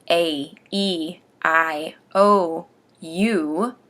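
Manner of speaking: "A, E, I, O, U" each said separately with a pause; deliberate vowel drill on "A, E, I, O, U"; vowel practice. Shown as a demonstration. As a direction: sound out "A, E, I, O, U" one full vowel at a time. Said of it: "I absolutely practice you saying".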